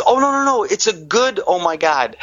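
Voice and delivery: high, breathless amazement easing down; amazed. Retold or imitated. Attendant reassuring the patient, then amazed. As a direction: gush it like you can't believe the numbers.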